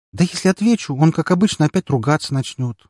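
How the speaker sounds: put-on high, grumbling girl's voice. A narrator's reading. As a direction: grumble it up high like a sulky girl. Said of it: "проворчала Распутина".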